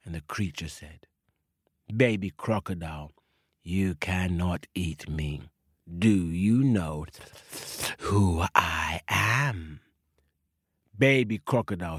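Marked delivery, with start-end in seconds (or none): 1.9-9.8 lower, slow, looming snake. Acted. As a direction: draw it out slow and low like a big snake. Snake voiced in story.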